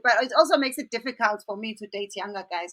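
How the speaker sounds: normal talking voice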